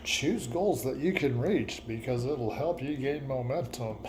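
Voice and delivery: ordinary delivery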